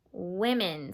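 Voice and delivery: "women" softly, short i, no S; quiet final "women" as wi-men; soft correct form. Shown as a demonstration. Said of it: "Not women's".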